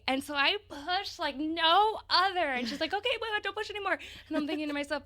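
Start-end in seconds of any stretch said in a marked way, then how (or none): 2.9-4.0 quick, high hurried stop, wait wait; urgent nurse warning. Retold or imitated. Nurse hurriedly telling the mother to stop pushing, urgent high voice. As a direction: rush to halt her push. Nurse stops her mid-push.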